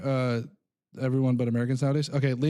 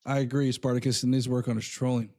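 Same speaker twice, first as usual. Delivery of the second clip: ordinary delivery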